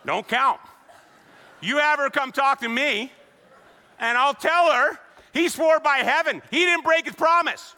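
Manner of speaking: loud, high, mocking sermon delivery; preaching at full force. Pastor ridiculing the scribes' loophole to his congregation, loud and high. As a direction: preach it hard, scorn the loophole.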